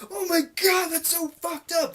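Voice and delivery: high, loud put-on shock; mock horrified outburst. Imagined viewer reacting to something disgusting, mock horrified.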